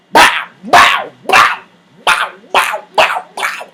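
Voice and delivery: rapid barked bursts of mock gunfire; imitated gunshots. Telling viewers shots were flying, imitated gunfire. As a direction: fire the words off like shots.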